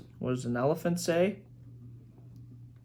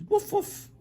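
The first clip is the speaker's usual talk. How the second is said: very high-pitched woofs; two squeaky little barks; dog bark. Acted. Parent voicing another dog, high dog bark. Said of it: "Another dog says".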